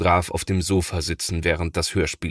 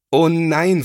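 high, loud, shocked "Oh nein" with stretched "oh"; dismayed cry; 9 semitones higher, 7 dB louder than usual. A narrator's reading. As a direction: wail "Oh nein" as a child seeing his toys ruined. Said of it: "ruft da sein Bruder Thomas". (entsetzter, lauter Ausruf "Oh nein")

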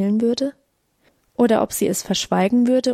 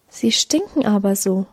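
plain reading voice

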